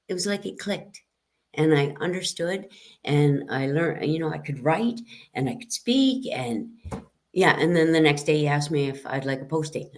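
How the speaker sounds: normal talking voice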